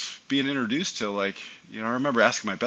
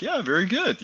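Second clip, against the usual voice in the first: higher-pitched, lightly acted praise; retold fatherly encouragement, pitched up; approving dad; 9 semitones higher than usual. Dad answering his son on the flight line, approving.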